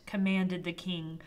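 usual speaking voice

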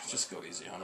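sigh, then a very soft plea; crestfallen. Retold or imitated. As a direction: imitate a deflated man quietly asking for mercy. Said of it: "His face dropped".

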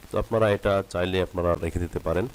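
ordinary talk

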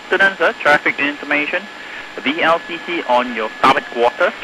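marine radio. Real, not acted. Traffic information over radio.

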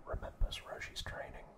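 normal talking voice

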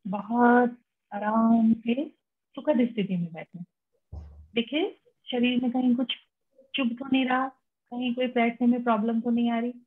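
unhurried meditation guide, long pauses between phrases; calm instructions with room to breathe; guided meditation. Real, not acted. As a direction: lead them gently into relaxation, phrase by phrase.

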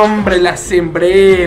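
ordinary talk, nothing marked

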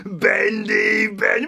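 put-on loud, high wilful boy; defiant shouting. Boy overruling his conscience, defiant shout. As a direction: shout back, set on doing it. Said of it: "I went".

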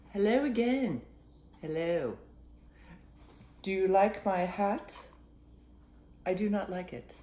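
character voices. Acted. Two dogs meeting, hat dialogue.

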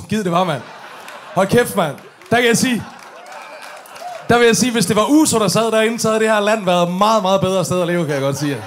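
loud, excited rant to the crowd; excited shout. Real, not acted. Comic telling a crowd, shouting with excitement. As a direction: wish it out loud, fired up.

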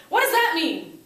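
loud, high outburst; frustrated kids. Acted. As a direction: blurt it like a baffled student. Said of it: "the kids go".

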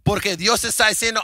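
ordinary talk

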